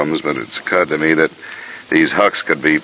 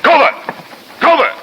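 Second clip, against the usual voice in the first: high, loud call after someone running off; shouting a name; 3 dB louder than usual. Acted. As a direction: call him back at full voice. Calling after a fleeing man.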